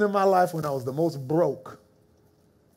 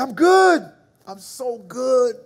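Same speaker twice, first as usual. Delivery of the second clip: ecstatic; 5 semitones higher, 4 dB louder, slower than usual. Retold or imitated. Joy to wife on phone.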